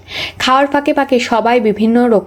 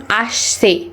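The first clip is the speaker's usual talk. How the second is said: slow, clear model of আসছে; deliberate teacher demo of আসছে; slow demonstration; much slower than usual. Shown as a demonstration. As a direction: say আসছে slowly with a clean স. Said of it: "আবার ছ উচ্চারণ করছেন কেন".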